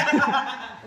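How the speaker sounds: loud, high burst of laughter. Real, not acted. Coach thanking the others for the handover, loud laugh.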